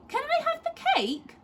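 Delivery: put-on very high boy's voice; little boy. A narrator's reading. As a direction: pipe up like a greedy small boy. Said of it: "asked Lester".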